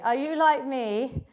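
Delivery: ordinary delivery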